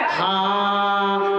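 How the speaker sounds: low, long-held single syllable; drawn-out dopey answer. Acted. Son answering mother-in-law by rote, comically drawn out. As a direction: stretch the word out on one low note.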